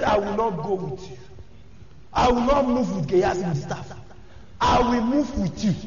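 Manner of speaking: low, firm, softened refusal; mother refusing. Retold or imitated. Preacher voicing the mother refusing to leave Elisha, low firm plea. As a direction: dig in quietly, she won't leave without him.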